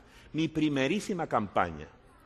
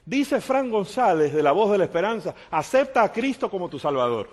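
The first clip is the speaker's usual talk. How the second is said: proud headline proclaim; 7 semitones higher, 7 dB louder than usual. Retold or imitated. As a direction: announce the headline high and loud. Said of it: "¿saben cuál decía la plana?".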